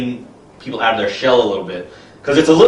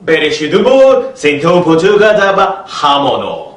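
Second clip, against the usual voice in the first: raised, louder mock-foreign gibberish; gibberish expert; 3 semitones higher, 4 dB louder than usual. Gibberish expert explaining alligator soup, raised and loud. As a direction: jabber like a self-important foreign expert. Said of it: "an example of gibberish expert translation".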